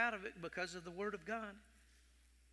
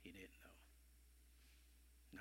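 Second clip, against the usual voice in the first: whisper; 11 semitones lower, 22 dB quieter, much slower than usual. Real, not acted. Preacher confiding to the congregation, whispered. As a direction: drop to a low hushed whisper. Hushed aside in sermon.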